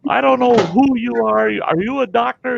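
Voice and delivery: high, agitated questioning; frantic demand. Retold or imitated. Patient to the surgeon who came running, frantic demand.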